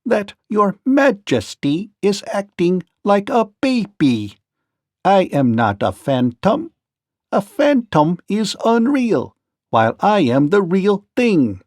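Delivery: lightly voiced calm machine; Tik-Tok calmly scolds the rolling king. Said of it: "said Tik-Tok calmly".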